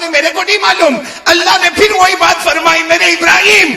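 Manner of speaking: climbing, high-pitched sermon delivery; rousing preaching. A narrator's reading. Ibrahim objects that he cut up the birds, mounting preacher's delivery. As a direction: build from protest to proclamation.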